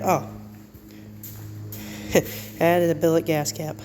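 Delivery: ordinary delivery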